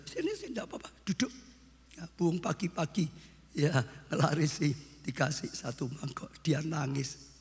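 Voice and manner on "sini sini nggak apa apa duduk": soup seller inviting. Acted. Soto seller calls the hungry runaway over, high acted invitation. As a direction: act out a kindly vendor's invitation, pitched up. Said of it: "Lalu bapaknya suruh dia".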